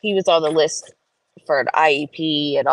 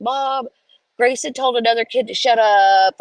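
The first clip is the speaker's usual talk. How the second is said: higher, tattletale brother voice; tattling kid; 5 semitones higher than usual. Retold or imitated. Zach telling mom about Grayson, tattletale kid voice. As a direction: tell on your brother.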